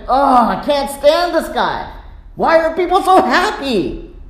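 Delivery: high-pitched indignant complaint; exasperated outburst, voice rising high. Acted. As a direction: fume like an offended priest. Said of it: "the chief priests are indignant".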